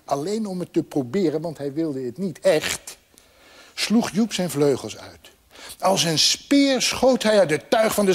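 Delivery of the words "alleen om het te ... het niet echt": plain reading voice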